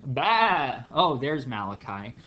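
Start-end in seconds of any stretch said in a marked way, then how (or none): 0.1-0.8 a louder, higher mock sheep bleat. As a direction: bleat like a sheep answering its name. Sheep answering roll call.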